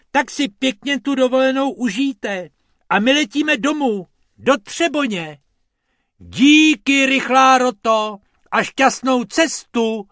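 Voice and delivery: high, loud parting shouts; shouted farewell. A narrator's reading. Rabbits and tourist parting by the jeep, shouting high and loud. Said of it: "křikl pan Pumpernagel".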